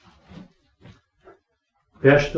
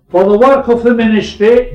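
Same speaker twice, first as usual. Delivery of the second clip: emphatic point; 7 semitones higher, 8 dB louder than usual. Acted. Listing three points, emphatic.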